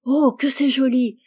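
put-on little girl marvelling at fireworks; delighted exclamation. A narrator's reading. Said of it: "s'écria la petite fille". (exclamation émerveillée)

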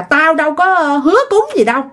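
acted stubborn protest; stubborn defiance. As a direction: push back as a pig-headed man. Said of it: "ông Tám Sản ổng rất là ngang".

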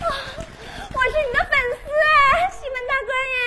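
swooning fan screaming for him; squealing fangirl. Acted. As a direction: fangirl squeal at Ximen Qing. Fangirl calling Ximen.

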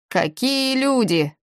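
high, drawn-out exclamation of greeting; exclaiming. A narrator's reading. Лоу greets Ди at the silo, exclaiming. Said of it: "воскликнул Лоу".

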